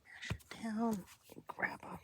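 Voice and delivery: breathy whisper while crafting. Real, not acted.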